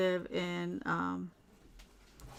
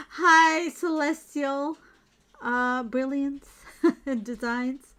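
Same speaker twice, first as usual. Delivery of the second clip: high, loud, slow welcome; excited greeting; 6 semitones higher, 9 dB louder, slower than usual. Real, not acted. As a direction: greet her warmly, stretching the name. Greeting a viewer with a laugh.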